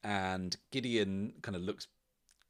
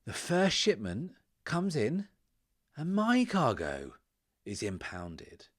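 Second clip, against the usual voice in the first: indignant, higher voice with heavy emphasis; slow, swelling outrage in phrases split by pauses; aggrieved drunk merchant; 6 semitones higher, 5 dB louder than usual. Acted. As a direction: complain loudly, leaning hard on key words.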